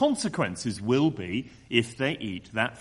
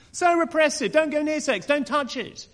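higher, sing-song parody of a critic's "So repressive"; mocking commentator; 12 semitones higher than usual. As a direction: lampoon the pundit's scolding tone. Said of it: "if they get the right commentator".

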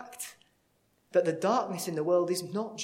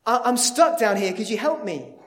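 loud call for help from a pit; stuck man yelling up for help; shouting up; 5 semitones higher, 10 dB louder than usual. Acted. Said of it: "and he shouts up".

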